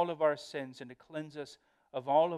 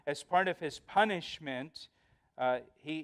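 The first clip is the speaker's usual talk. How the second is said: usual speaking voice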